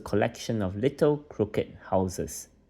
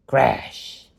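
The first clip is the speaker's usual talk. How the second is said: a loud, higher shout of the slam; door crash; 5 semitones higher, 7 dB louder than usual. Acted. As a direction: bang out the crash like the door itself. Door slammed in story.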